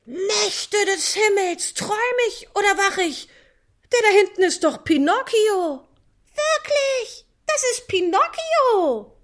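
a stage puppet hamming up astonishment; highly dramatic shout. Acted. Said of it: "mit hochdramatischer Stimme schreit".

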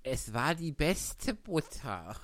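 meek and slow, mildly voiced; humble March Hare. A narrator's reading. The March Hare humbly excuses himself, meek and slow. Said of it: "sagte der Faselhase demütig".

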